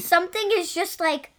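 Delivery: ordinary delivery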